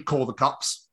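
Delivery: normal talking voice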